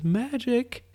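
put-on high, bright child saying magic; kid voice. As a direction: say it like a proud little kid.

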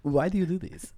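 high, hammed-up Italian accent; mock Italian accent. Grandfather baffled by acting career, mock Italian accent. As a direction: repeat it as comic Italian bafflement. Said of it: "And that's the Italian accent".

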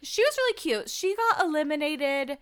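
ordinary talk, nothing marked

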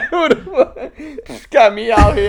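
high, loud, slow laughing rant; laughing mock rant. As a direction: shout it through laughter. Quoting viral clip, laughing.

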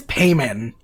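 Payman said PAY-mun, with a long A; correct pronunciation. Shown as a demonstration. Correcting listeners in a mock announcement, Payman said PAY-mun. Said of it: "That is how you say it".

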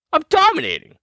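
high, crowing repeat; high boast. Acted. Host boasting about his league, high crowing repeat. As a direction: crow it up high.

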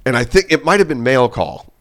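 usual speaking voice

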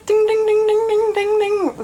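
high-pitched mimicked dinging noise; rapid run of high sing-song dings; imitated dinging. Imitates noise of slow internet.

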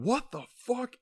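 high-pitched mock disbelief; mock shock. Everyone seeing Chopper burst in, high mock shock.

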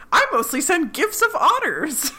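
very high, cutesy chirp; lightly voiced sweet Janet. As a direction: announce it proudly, all sweetness.

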